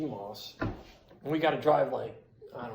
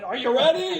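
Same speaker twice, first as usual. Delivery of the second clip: high, loud imitated holler; rowdy mock yell; Dennis Rodman yelling; 10 semitones higher, 4 dB louder than usual. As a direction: holler it out like a hype man. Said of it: "he just kept yelling".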